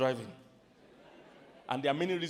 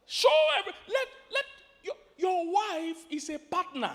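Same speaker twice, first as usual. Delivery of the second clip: preaching climax; 12 semitones higher, 4 dB louder than usual. Real, not acted. Preacher urging husbands, high ringing call.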